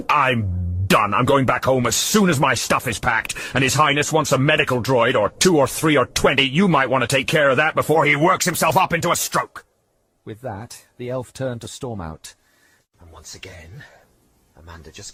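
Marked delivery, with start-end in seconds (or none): 0.1-9.6 loud, fuming declaration; storming off in anger; seething, louder. Acted. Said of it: "She fumed".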